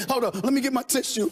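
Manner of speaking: ordinary talk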